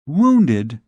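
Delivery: shocked, questioning echo of the word Wounded; a touch of alarm on Wounded. Acted. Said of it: "She, poor child, was completely upset".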